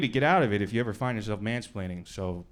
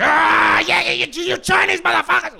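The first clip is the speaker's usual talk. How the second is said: much higher, louder raving yell; wild homeless rant; 10 dB louder than usual. Retold or imitated. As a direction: rage at them like a street ranter. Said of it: "starts going wild".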